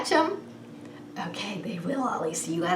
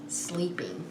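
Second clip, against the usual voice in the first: usual speaking voice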